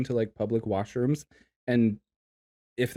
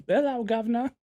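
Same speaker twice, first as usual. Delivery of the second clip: high, hammed-up British greeting; mock British accent; 10 semitones higher than usual. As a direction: flamboyant fake Cockney hello. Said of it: "Be like".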